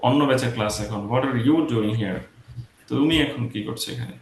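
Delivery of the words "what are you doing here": "you" picked out, falling to "here"; slow question with emphatic "you"; stress on YOU. Shown as a demonstration. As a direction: lean on "you" as someone out of place. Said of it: "তোমার তো এইখানে থাকার কথা না".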